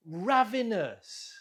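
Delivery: RAV stressed high in Ravenous, then falling; stress on first syllable. Shown as a demonstration. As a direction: model Ravenous clearly, leaning on the first syllable. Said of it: "stress on the first syllable".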